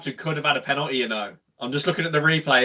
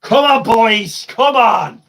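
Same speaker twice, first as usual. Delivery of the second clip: rallying shout; 6 semitones higher, 7 dB louder, much slower than usual. Real, not acted. Urging the team on.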